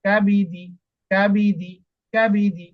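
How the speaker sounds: teacher reading each syllable clearly for the student; teacher's model reading. Shown as a demonstration. Teacher models the word for a student, clear and careful. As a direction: say it slowly and clearly for the learner. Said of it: "বুঝতে পারছেন?".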